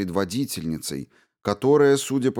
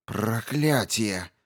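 slow bitter curse; 2 semitones higher, much slower than usual. Acted. Leader trapped, cursing.

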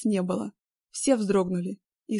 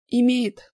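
важно; 3 semitones higher, 8 dB louder than usual. A narrator's reading. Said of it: "с осознанием своей важности изрекла".

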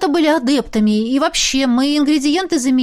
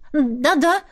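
flustered; 6 semitones higher than usual. A narrator's reading. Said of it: "растерянно протянула Беата".

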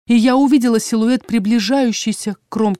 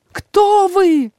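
high-pitched, drawn-out cry; alarmed, high call; desperate shout; 11 semitones higher, 4 dB louder than usual. A narrator's reading. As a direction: cry it out after a vanishing figure. Heroine shouts at vanishing figure.

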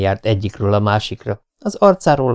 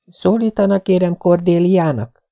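eager; 9 semitones higher than usual. A narrator's reading. Anne eagerly asks Marilla to call her Cordelia. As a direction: ask hopefully. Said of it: "buzgón kérdezte".

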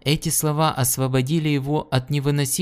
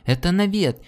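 shocked cry; 9 semitones higher than usual. Acted. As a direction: cry out in disbelief. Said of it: "вскричал Джаларн".

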